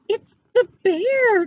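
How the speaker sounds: frightened cry. A narrator's reading. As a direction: cry out in fright as a small boy. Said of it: "cried Eddie".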